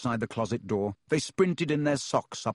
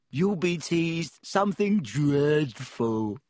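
slow, higher gleeful ghost; happily; 4 semitones higher, slower than usual. Acted. Myrtle delighting in Hermione's trouble, slow and gleeful. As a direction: relish the teasing, drawn out. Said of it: "said Myrtle happily".

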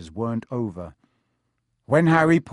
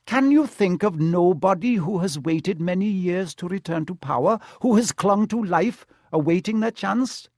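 higher, pressing questions; urgent questioning; 7 semitones higher, 4 dB louder than usual. A narrator's reading. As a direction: press the listener to grasp a dark truth. Centaur urgently questions Harry.

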